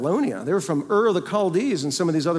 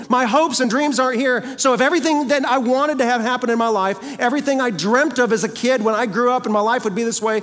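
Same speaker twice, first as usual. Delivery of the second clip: raised, forceful preaching pitch; insistent, higher-pitched testimony; emphatic declaration; 5 semitones higher, 4 dB louder than usual. Shown as a demonstration. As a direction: press each point home emphatically. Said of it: "we speak very emphatically".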